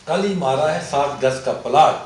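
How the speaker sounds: put-on emphatic Pashto-accented recitation. Poet repeating his couplet for the host, Pashto accent. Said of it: "میں پڑھتا بھی اسی لہجے میں تھا".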